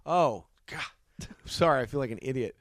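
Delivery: overdone sheepish sorry; mock apology. Actor apologizes to casting people in mock embarrassment.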